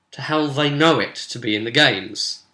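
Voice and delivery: ordinary talk, nothing marked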